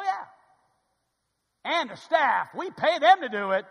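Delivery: loud bursts of mock congregational agreement; punchy sermon voice, jumping up to shouts; fired-up preaching. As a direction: act out eager amens from the pulpit. Preaches, acting out congregation's replies.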